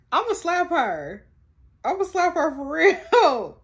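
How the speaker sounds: high, loud, drawn-out threat; exasperated outburst. Real, not acted. Telling viewers she'll slap the childminder, loud drawn-out exasperation.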